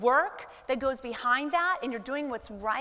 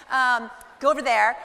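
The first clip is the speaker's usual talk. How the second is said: shooing away; 10 dB louder than usual. Retold or imitated. Mom shooing husband away.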